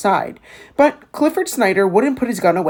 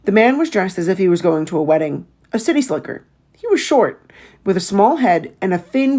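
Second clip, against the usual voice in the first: plain reading voice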